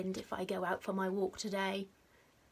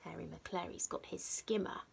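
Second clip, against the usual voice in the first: ordinary delivery, nothing marked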